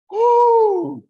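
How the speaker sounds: high loud whoop; whooping at the wild ride. Real, not acted. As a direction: let out a loud, high woo. Scary ride with grandma.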